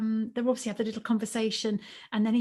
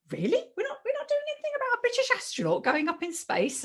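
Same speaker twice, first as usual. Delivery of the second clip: incredulous disbelief; 14 semitones higher, faster than usual. Retold or imitated. Teacher stunned at missing topic.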